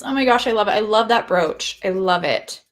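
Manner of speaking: normal talking voice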